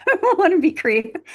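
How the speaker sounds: breaks into a laugh, high and loud; laughing at herself. Real, not acted. Mother telling interviewer about beading, laughing at herself. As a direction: laugh it off as you correct yourself. Laughing at her own claim.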